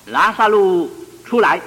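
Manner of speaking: shouting a name at full voice, pitched high; loud call. A narrator's reading. Jesus calling Lazarus out of the tomb, loud shout. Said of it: "就大声呼叫说".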